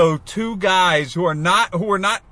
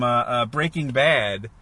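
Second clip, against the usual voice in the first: normal talking voice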